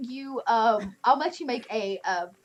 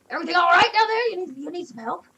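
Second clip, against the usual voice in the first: put-on higher, quick folksy old man; 5 semitones higher, 4 dB louder, faster than usual. Old driver calling down to the hidden orcs. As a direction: call down the bank, concerned. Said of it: "and he's like".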